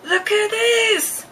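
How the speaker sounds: high, slow, drawn-out "look at this"; eager showing off. Acted. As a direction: stretch it out, thrilled to show it off. Winnie shows off the cane.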